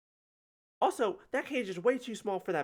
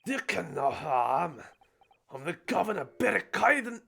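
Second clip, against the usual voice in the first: hammed-up Scottish governor, louder; Scottish accent; 5 dB louder than usual. Governor introducing himself, in a hammed-up Scottish accent. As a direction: boom it in a stage Scottish accent. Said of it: "Oh, he is Scottish?".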